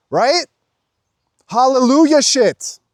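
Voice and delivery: high, loud hallelujah outburst; exultant exclamation. Real, not acted. Vlogger celebrating to viewers, loud and exultant. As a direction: cry it out exultantly. Peak of fervent exhortation.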